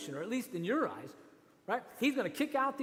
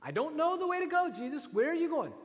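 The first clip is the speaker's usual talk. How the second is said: higher, louder puzzled voice; confused disciple; 8 semitones higher, 4 dB louder than usual. Acted. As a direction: ask it high and lost.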